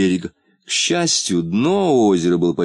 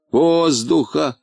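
Воздуха! drawn out slowly, higher, with a falling tune; mocking echo; 7 semitones higher, much slower than usual. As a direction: mimic the word Воздуха back, slow and scornful. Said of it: "передразнил Полботинка".

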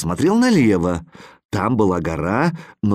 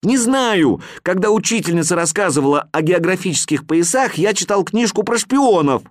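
put-on guilty boy; 8 semitones higher than usual. A narrator's reading. Boy admits to the animals he read spy books, flustered. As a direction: blurt it out guiltily.

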